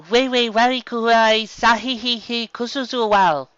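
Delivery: high, loud, slow cutting words in a strange tongue; foreign tongue. Acted. Younger zebra speaking to wound Rainbow, high, loud and slow. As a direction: slice each word at her.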